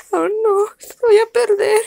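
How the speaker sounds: high, fretful whine; worried dog. Acted. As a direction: fret about losing. Dog fears losing.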